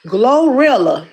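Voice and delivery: slow, deliberate go at a tricky name; careful, drawn-out pronunciation. Real, not acted. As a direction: stretch out the syllables to get it right. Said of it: "I can barely say her name".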